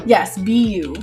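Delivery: ordinary delivery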